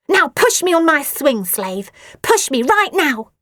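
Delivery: high, loud barked command; bossy order. A narrator's reading. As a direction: order the slave about. Asti ordering her slave.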